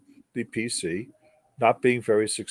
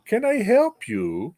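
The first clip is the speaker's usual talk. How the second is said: put-on a gentle, lilting European accent, higher and slow; 9 semitones higher than usual. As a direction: imitate a shy young European student offering help, lilting. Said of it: "with a very lilting European accent".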